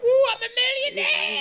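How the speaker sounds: very high, loud winner's whoop; mock jubilant scream. Bettor celebrating as hell opens up, mock jubilant scream. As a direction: shriek it like a hammed-up jackpot winner. Acts out winning the absurd bet.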